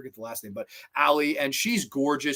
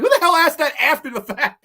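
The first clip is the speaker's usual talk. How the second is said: incredulous, higher and louder; mock outrage; 11 semitones higher, 6 dB louder than usual. Reviewer reacting to the character's tactless line, mock outrage. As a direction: raise it in mock disbelief.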